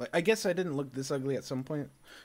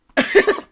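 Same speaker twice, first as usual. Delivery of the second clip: shrieking high laugh; mocking cackle; 19 dB louder than usual. The internet cackling at him, a high shrieking laugh.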